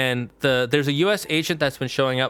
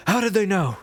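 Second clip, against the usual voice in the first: Bond outburst; 6 semitones higher than usual. Retold or imitated. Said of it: "the James Bond way".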